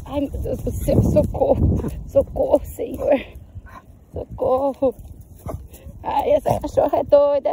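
loud, high cries for help, some half-breathed; real playful panic, shouting socorro; real panicky yelling. Real, not acted. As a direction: yell for help as the dog drags you. Dog pulling her toward the sea.

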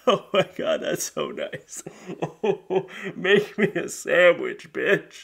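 put-on voice with a laugh; gush, then snap the insult; hammed-up creep; sexist guy. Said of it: "he's just like".

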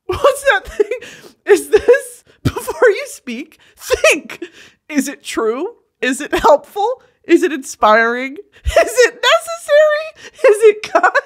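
high, giggling, rising questions; laughing recitation. Real, not acted. Laughing through the poster's questions, pitch high. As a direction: read the checklist while cracking up.

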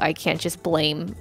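usual speaking voice

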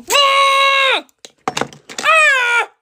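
high loud cry of frustration; high frustrated yell; frustrated scream. Real, not acted. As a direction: shriek it out in exasperation. Lashes not working.

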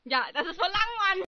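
loud, rising whine of protest; exasperated complaint. Real, not acted. Voice actor moaning about a long line, exasperated. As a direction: complain loudly, pitch climbing.

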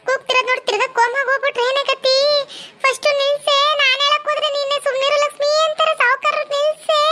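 high-pitched, anxious urging; urgent alarm. Acted. Woman fearing the patient relapses from shouting, urgent alarm. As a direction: press her urgently to stop him.

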